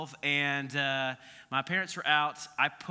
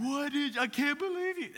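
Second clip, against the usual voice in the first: shocked mom; 10 semitones higher than usual. Retold or imitated. Mom scolding in story.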